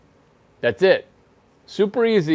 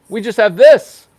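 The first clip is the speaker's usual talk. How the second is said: higher and much louder, punching THIS; shouted punchline; 6 semitones higher, 10 dB louder than usual. Shown as a demonstration. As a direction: belt out the word "this". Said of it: "repeated punchline, shouted".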